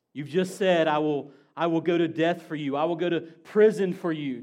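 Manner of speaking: fervent sermon build; impassioned preaching. Acted. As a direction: drive the words home to the congregation. Preaching Peter's shoes, building.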